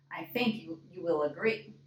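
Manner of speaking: plain reading voice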